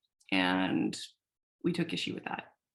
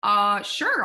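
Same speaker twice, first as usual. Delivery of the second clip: mock surprised; 2 semitones higher, 6 dB louder, much slower than usual. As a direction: play the friend faking delight. Friend reacting to offer.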